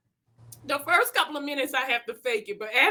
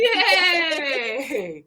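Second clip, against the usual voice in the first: excited yay; 4 semitones higher than usual. Acted. Cheer ending the exercise.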